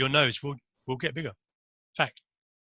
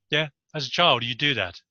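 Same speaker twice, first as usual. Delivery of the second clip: ordinary talk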